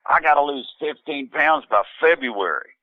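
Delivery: high-pitched mock dismay; retold fretting, pitched well up; exasperated complaint. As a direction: imitate a wife's exasperated announcement. Wife dismayed by wedding invitation.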